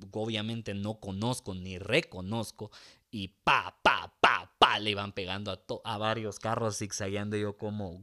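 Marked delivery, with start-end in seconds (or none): 3.3-4.8 punchy, loud impact noises; imitated crashes. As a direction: punch out each bang.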